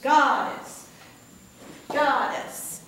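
Goddess with the first syllable God punched; stress on God. Shown as a demonstration. Teacher showing the class which syllable of Goddess gets oomph. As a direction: hit God, let dess fall away. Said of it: "God gets the emphasis".

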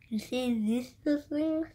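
wearing a retainer; talking while removing retainer; fingers in mouth. Real, not acted. Seen 2: Retainer worn; fingers grip clasps at teeth, taking it out, not in.

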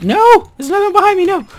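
sudden high yelp of panic; panicked shout. Real, not acted. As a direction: scream as you're ambushed. Zombie attacks from behind.

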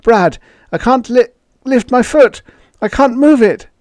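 high, loud cry of stark terror; panicked shout, pitched far up; startled cry. A narrator's reading. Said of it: "startled cry".

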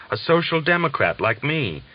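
a prisoner naming his politics, eagerly; excited. Acted. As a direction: lift "social democrat like me" with joy. German says the familiar words.

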